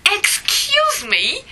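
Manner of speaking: high, slow, drawn-out interjection; Sheldon Cooper. Retold or imitated. TV theorist cutting in on a scolding, high and indignant. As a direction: draw it out high like an affronted TV character. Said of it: "says Big Bang theorist Sheldon Cooper".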